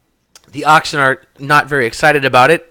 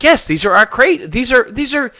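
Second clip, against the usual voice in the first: excited; 11 semitones higher, 5 dB louder than usual. Acted. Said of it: "She's like".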